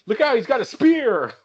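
hammed-up panicked warning; mock alarm. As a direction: cry out like a tiny frightened soldier. Mocking Ewok battle cry.

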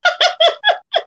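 sudden high, loud burst of laughter. Real, not acted. Friend cracks up about matching hairstyles, laughing out loud.

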